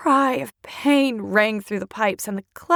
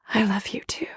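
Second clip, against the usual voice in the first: faint whispered reply; 5 dB quieter, faster than usual. Acted.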